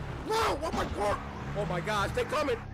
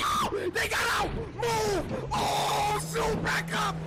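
panicked shouting; 7 semitones higher, slower than usual. Real, not acted. Gamer fleeing car rammers, frantic shouting. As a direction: shout in alarm at the attackers.

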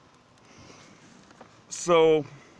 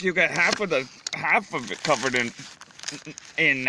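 usual speaking voice

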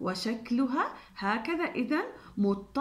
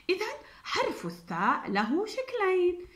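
giggly whisper; 8 semitones higher than usual. Acted. Playful reveal to children.

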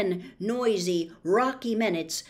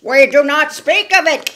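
put-on loud, fierce old spider voice; 6 semitones higher, 15 dB louder than usual. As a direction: forbid it with a fierce boom. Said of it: "said Aragog fiercely".

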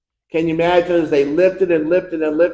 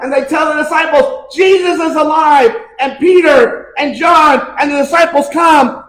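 loud, high, jubilant preacher's shout; full-force preaching; 11 semitones higher, 8 dB louder than usual. Acted. Preacher telling the church that Jesus is alive, shouting joyfully. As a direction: shout the good news to the back row.

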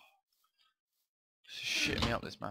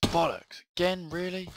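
loud, higher angry outburst; frustrated curse; 9 semitones higher, 8 dB louder, slower than usual. Real, not acted. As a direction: spit out the swear at the game. Failing the jump again.

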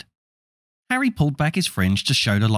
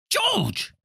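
scolding mother. Acted.

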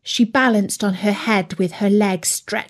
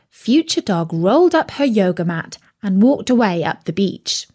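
usual speaking voice

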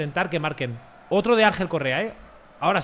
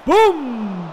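loud, high vocal boom; explosive "boom"; 6 semitones higher, 7 dB louder than usual. Real, not acted. Gamer sounding out his own shot, explosive boom. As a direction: belt out an impact noise.

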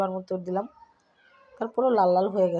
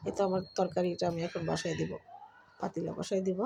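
quiet, hoarse from a cold; 2 semitones lower, 5 dB quieter than usual. Real, not acted. Sick cook talking softly to viewers. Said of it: "আস্তে আস্তে কথা বলবো".

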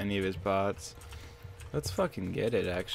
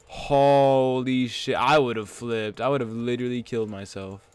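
normal talking voice

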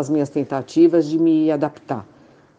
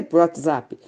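ordinary delivery

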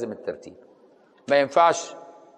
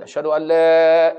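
raised voice demo; 4 semitones higher, 8 dB louder, slower than usual. Shown as a demonstration. Teacher showing the raised second shahada, called out. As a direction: call أشهد أن لا out loud and high. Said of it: "ترفع بقى".

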